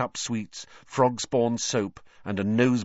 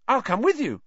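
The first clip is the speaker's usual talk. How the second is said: high-pitched eager offer; bright; 4 dB louder than usual. A narrator's reading. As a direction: pipe up brightly, much higher. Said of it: "said Neville brightly".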